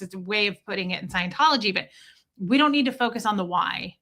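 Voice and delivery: ordinary talk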